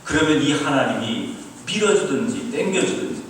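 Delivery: put-on loud Busan-accented scolding, pitch swinging up; God in Busan dialect. God answering the complaining student, Busan-dialect scolding. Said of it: "하나님도 부산 사투리로 응답해 주시더라고요".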